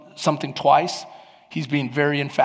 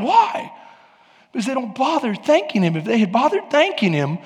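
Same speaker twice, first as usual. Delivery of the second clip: raised pitch, insistent preaching; impassioned preaching; 7 semitones higher, 5 dB louder than usual. Real, not acted. Preacher recounting Israel's meltdowns in the desert, preaching insistently. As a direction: hammer home each repeated failing.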